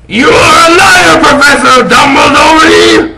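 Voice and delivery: high, loud accusing yell; angry shout. Acted. Shouting at Dumbledore, calling him a liar. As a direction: hurl the accusation.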